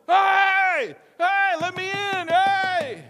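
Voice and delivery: loud, high drawn-out shouts; yelling. Acted. Peter outside calling to those indoors, yelling. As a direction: holler from outside a locked door. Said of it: "guess who's still standing outside yelling".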